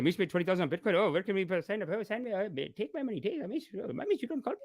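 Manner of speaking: whisper-gossip like excited community aunties; mocking gossiping relatives. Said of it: "They're saying".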